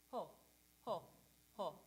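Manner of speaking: short bouncy ho, lips rounded; change only the lips from ah to o; staccato ho. Shown as a demonstration. Coach showing choir the ho variant. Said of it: "Now let's try ho".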